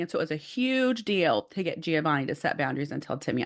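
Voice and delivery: usual speaking voice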